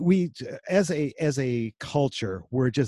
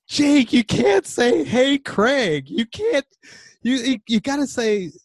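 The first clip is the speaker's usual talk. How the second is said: exasperated dad; 8 semitones higher, 6 dB louder than usual. Retold or imitated. Dad scolding his son about a casual email, high and loud. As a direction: lecture him loud and high, half laughing. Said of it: "and I'm like".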